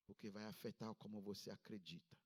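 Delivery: ordinary talk, nothing marked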